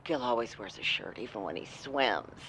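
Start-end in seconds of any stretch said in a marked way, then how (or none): none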